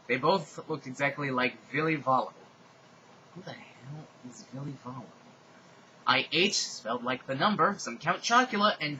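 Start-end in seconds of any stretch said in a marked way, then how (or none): 3.3-5.0 breathy whispered aside. Real, not acted. Reader's private aside to listeners, whispered. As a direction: drop to a whisper for the joke. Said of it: "whispered aside".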